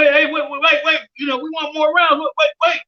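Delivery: high, urgent pleading to stop; mock frustrated protest. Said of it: "They frustrated. They're like".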